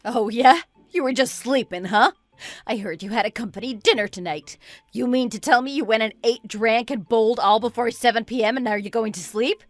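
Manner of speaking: ordinary talk, nothing marked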